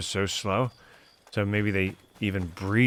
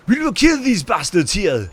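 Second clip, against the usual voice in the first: higher, louder, slow mock French accent; 11 semitones higher, 10 dB louder, slower than usual. Imitating Stefan's old French accent to mock the change. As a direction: ham up a French accent, drawing each word out. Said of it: "his French accent totally went out the window".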